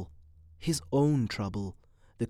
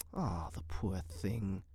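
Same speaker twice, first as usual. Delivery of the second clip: soft, slow, lowered sympathy; compassionate; 5 semitones lower, 9 dB quieter, slower than usual. A narrator's reading. Woman pities the crippled locust, softly compassionate. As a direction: sigh it tenderly, very softly. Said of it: "catching her breath in compassion".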